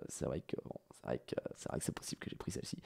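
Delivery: chuchoté. Acted.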